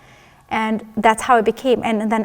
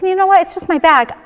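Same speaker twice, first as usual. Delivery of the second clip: higher, louder breezy dismissal of the pain; brushing it off; 8 semitones higher, 4 dB louder than usual. Retold or imitated. Telling her husband it's only her back, breezy and dismissive. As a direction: wave it off like it's nothing.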